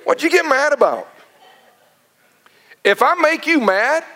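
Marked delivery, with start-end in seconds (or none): 0.0-1.1 high, incredulous what-did-you-get-mad-about; incredulous question. Acted. Pastor asking the man why he got mad, incredulous. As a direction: ask it in amazed disbelief. Asks the man in mock disbelief.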